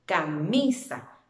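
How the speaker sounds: slow Camisa, middle MI stretched; ca-MI-sa, leaning on the mi; stress on MI. Shown as a demonstration. Teacher repeating the answer, Camisa stressed on mi. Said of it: "En la sílaba mi".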